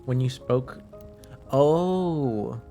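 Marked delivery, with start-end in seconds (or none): none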